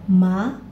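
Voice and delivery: ma rising; second tone ma. Shown as a demonstration. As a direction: lift ma upward. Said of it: "saya beri nada kedua".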